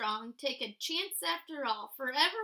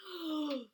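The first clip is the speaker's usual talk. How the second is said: sharp gasp; 2 semitones lower, 4 dB quieter than usual. Acted. Suddenly nose to nose.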